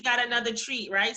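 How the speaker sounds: ordinary talk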